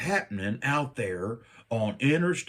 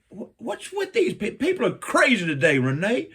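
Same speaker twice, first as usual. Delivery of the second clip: laughing exasperation; 5 semitones higher, 5 dB louder than usual. Retold or imitated. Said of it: "I'm like".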